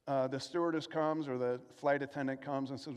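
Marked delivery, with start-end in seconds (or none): none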